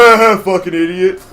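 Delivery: lightly acted mocking laugh, then a jibe; mock jeering onlooker. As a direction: jeer like someone watching your blunder. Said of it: "Someone's gonna watch me with a bloodstain and go".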